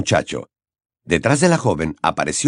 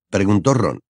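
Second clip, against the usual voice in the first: plain reading voice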